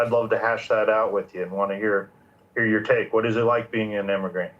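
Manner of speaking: usual speaking voice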